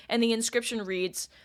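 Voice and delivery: normal talking voice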